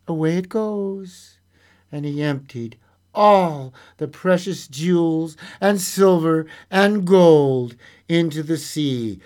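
lively storyteller voice, acting out the tale; story narration. A narrator's reading. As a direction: read it like a bedtime fairy tale. Storyteller reading a fairy tale.

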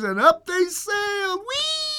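very high squealed "whee"; giddy high-pitched storytelling; gleeful soaring. Acted. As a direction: squeal with joy as you sail up. Flight soars up in story.

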